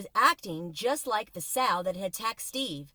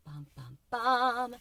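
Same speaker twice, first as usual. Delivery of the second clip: dramatic bum bum bum; 3 semitones higher, slower than usual. Acted. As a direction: tease the cliffhanger. Cliffhanger sting.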